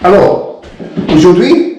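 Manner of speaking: a lightly done French announcement; halting English-accented French. Real, not acted. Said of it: "Apologies for the pronunciation".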